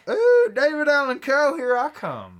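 high, loud gleeful squeal; excited squeal. Pretend. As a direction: gush like a thrilled fan. Mocked friend excited for country.

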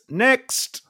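high, called out to the wings; calling out. Real, not acted. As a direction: summon the next one in. Host calling next contestant.

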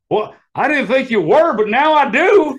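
loud, high sarcastic retort; mock reply. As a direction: land the punchline with rising disbelief. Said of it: "And I'm like".

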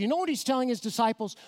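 normal talking voice

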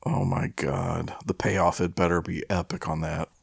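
ordinary delivery

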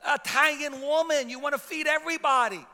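hammed-up nagging complaint; exasperated wife. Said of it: "my wife gets mad at me".

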